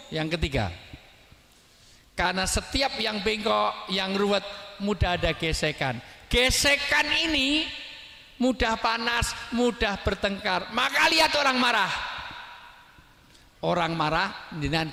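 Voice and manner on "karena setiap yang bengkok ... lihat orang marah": high-pitched sermon build-up; impassioned preaching. Real, not acted. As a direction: drive the point home in a raised preacher's voice. Preaching at raised force. (khotbah lantang bernada tinggi)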